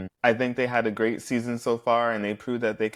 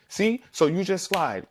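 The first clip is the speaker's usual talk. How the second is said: higher, triumphant gotcha; 7 semitones higher than usual. Retold or imitated. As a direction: catch him in the lie. Retold accusation, caught lying.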